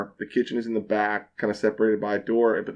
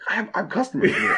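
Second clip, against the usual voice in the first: higher, quick and half laughing; laughing protest; 8 semitones higher, faster than usual. Retold or imitated. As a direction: plead through a laugh. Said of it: "I'm like".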